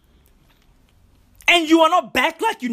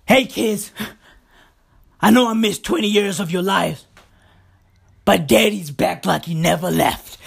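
lower, lightly voiced dad; returning dad; 5 semitones lower than usual. Father returning after twenty years, lightly voiced and lower. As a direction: play the dad breezily, falling lower. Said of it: "a dad like that".

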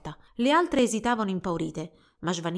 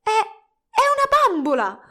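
very high, loud, drawn-out astonishment; startled discovery; 12 semitones higher, 9 dB louder, slower than usual. A narrator's reading. Girl discovering her rival is a doll, astonished cry. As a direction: cry out in shock, stumbling then stretching the words. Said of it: "Poi sussultò".

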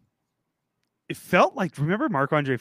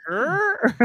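laughing; 7 dB louder than usual. Real, not acted. Laughing while answering friend.

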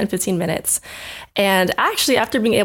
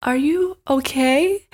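concerned roommate; 6 semitones higher, much slower than usual. Retold or imitated. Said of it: "And they were like".

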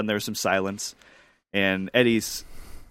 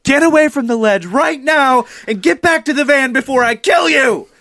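very high, loud, hammed-up scolding shout; imitated frantic yell; 9 dB louder than usual. Scaredy-cat friend calling them off the ledge, frantic yell. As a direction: scream the order like a panicked mother. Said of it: "John was like".

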